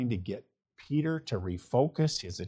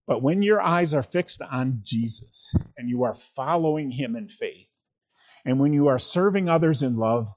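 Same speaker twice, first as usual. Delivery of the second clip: louder, driving sermon delivery; full-force preaching; 6 dB louder than usual. Acted. Pastor proclaiming freedom from comparison, full preaching voice. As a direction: preach it out to the congregation.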